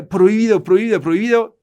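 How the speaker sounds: loud, high, insistent prohibido chant; mock forbidding cry, loud and high; mock shout of prohibido. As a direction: hammer out the ban like a stern preacher, mocking. Mocking the forbidding of things.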